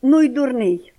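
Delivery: loud, cross snap from the aunt; angry. A narrator's reading. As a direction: spit it out, annoyed. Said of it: "каже вже сердито". (сердито, голосно)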